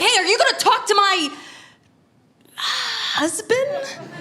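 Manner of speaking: high, loud call after someone walking off; chasing the urologist down the hall, calling out loudly; calling after him. Retold or imitated. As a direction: shout after the departing doctor, half laughing.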